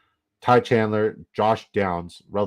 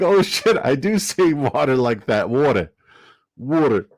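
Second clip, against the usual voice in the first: laughing loud quote of a friend realizing he says "water" that way; a shocked New Yorker, cracking up; mock dismayed friend; 3 semitones higher, 5 dB louder than usual. Host laughing, quoting Antonio's surprise. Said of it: "Antonio's like".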